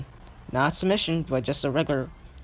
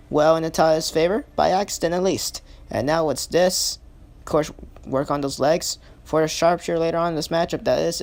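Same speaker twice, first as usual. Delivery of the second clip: ordinary delivery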